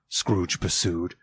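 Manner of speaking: ordinary delivery, nothing marked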